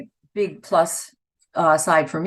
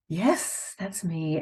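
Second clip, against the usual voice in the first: dismayed recognition; 8 dB quieter, much slower than usual. Retold or imitated. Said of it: "and I went".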